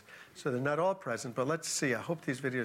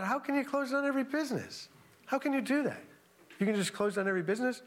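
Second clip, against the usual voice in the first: much higher, disbelieving questions, faster at the end; incredulous; 9 semitones higher than usual. Retold or imitated. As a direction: raise it up in stunned disbelief. Disbelief at national shutdown.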